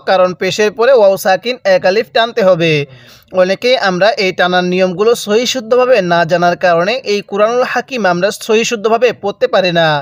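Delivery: ordinary talk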